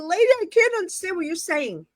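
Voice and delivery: high-pitched retold pleading; high, frustrated reply at normal volume; exasperated protest. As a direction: push the pitch up in mock exasperation. Retold exasperated reply to screaming woman.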